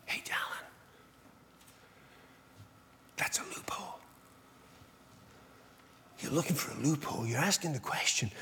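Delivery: hushed, soft inner voice whispering. Retold or imitated. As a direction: whisper it close like a quiet conscience. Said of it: "he'll whisper to me".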